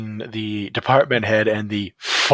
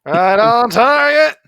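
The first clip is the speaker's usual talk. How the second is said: mock approval echo; 10 semitones higher, 6 dB louder, slower than usual. Real, not acted. Echoing the joke.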